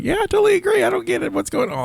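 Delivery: very high-pitched mocking imitation; mock agreeing friend. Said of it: "another person that we both know was like".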